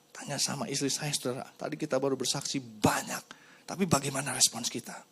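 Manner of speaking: ordinary delivery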